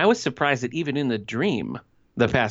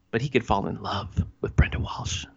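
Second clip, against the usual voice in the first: whispered quote; 3 semitones lower than usual. Retold or imitated. Quoting Rick's line about Brenda Walsh, whispered. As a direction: breathe it like a swooning confession. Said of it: "His words".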